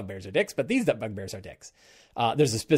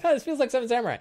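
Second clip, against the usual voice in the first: much higher knowing sing-song; squeaky mock-surprised jab; mock realisation; 11 semitones higher, much faster than usual. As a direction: play the players' teasing "aha". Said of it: "And it's like".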